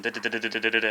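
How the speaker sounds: even, rapid "da" syllables like a machine gun; machine gun rhythm. Shown as a demonstration. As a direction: rattle off "da, da, da" evenly like gunfire. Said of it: "sound like a machine gun".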